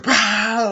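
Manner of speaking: sudden high, loud "bah"; short, loud mock attack yell; jump-scare bark. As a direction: burst out at them like a killer popping up. Michael jumps out attacking.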